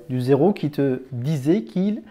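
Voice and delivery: normal talking voice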